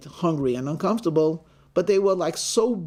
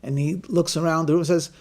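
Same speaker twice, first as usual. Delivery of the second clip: usual speaking voice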